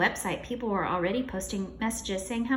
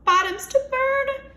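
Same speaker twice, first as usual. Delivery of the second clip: high, loud shout; teacher shouting at the screen; alarmed shout; 8 semitones higher, 7 dB louder than usual. Acted. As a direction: shout from the computer. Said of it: "shouted Mister Cooper".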